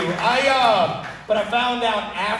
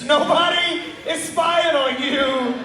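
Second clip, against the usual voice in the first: drawn-out, pitched-up scold; slow mocking scold; 6 semitones higher, slower than usual. Son slowly mocking his dad's spying fear, drawn out. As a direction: spell it out slowly for a paranoid dad.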